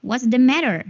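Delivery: American "matter" with an r ending; American accent. Shown as a demonstration. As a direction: clear American demo, r sounded.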